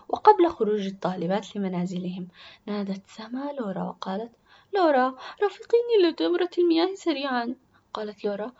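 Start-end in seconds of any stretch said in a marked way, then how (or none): 4.7-7.5 put-on high, loud girl's plea; urgent schoolgirl. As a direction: press her to come along right away. Sister urges Lora to come.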